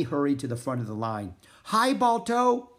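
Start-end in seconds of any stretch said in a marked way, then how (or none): 1.6-2.7 high, loud, drawn-out hail; excited greeting. A narrator's reading. Annie meeting Balto, excited greeting. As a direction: greet a hero with delight. Greeting her hero dog.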